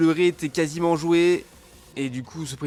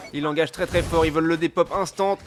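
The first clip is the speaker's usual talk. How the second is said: hyped esports casting, higher and louder; esports caster; 6 semitones higher, 7 dB louder than usual. Real, not acted. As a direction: call the final teamfight at full hype. Live casting of the final fight.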